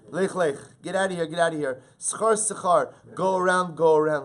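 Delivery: loud, high shooing-away; urgent warning. Acted. Warning the nazir away from wine, loud and urgent.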